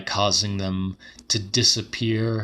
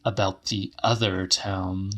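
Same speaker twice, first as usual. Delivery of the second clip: plain reading voice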